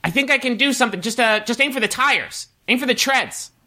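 high, squeaky mock yell; Hurley. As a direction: call up to them in a high voice. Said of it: "Hurley yells up from the front seat".

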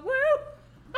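high-pitched imitated "woo"; sudden high woo, breaking into laughter; gleeful whoop. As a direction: playfully demonstrate a high whoop. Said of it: "I like to go".

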